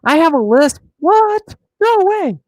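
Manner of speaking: high-pitched feigned disbelief; mock surprise. Feigned shock at having a lisp.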